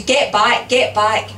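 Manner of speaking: loud urgent call to retreat; narrated scream. A narrator's reading. Bystander screaming at him to retreat, a contained scream. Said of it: "someone is screaming at him".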